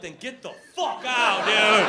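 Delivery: loud, high, drawn-out angry shout; hammed-up indignant yell; mock outrage. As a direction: blow up at the Brit, stretching the words. Mock-outraged reply to a Brit.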